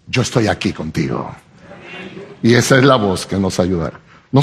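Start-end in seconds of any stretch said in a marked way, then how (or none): 0.1-1.4 deep soft reassurance. Acted. Preacher voicing God to those crying, low and tender. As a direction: lower and softer, calm and comforting. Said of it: "Dios dice".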